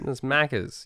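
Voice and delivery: "Maccas" ending in a soft "ah". Shown as a demonstration. Correcting Americans' hard R, "Maccas" ending in plain "ah". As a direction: say "Maccas" with no R at the end. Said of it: "It's an A".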